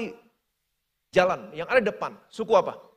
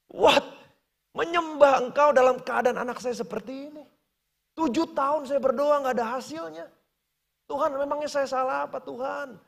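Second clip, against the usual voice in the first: angry protest; 6 semitones higher than usual. Retold or imitated. Frank shouting at God in the hospital, angry. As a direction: hurl the question at God. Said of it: "Dia makin marah".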